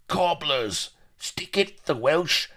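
high-pitched heckle from the crowd; jeering pirate shouting down a speaker; heckling cries. A narrator's reading. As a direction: cry out in raucous protest. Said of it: "Cries of".

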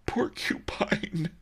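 high, slow tearful confirmation; drawn-out, high weepy whimper; sobbing. A narrator's reading. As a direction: sob the word out mournfully. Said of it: "Master Li sobbed".

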